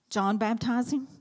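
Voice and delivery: ordinary delivery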